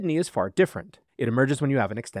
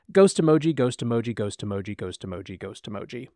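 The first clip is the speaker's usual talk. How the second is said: fading repetition; 4 semitones lower than usual. Retold or imitated. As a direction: let it trail off into silence. Repeated words fading away.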